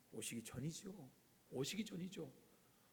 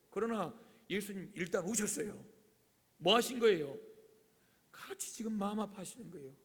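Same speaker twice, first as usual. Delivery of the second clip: ordinary talk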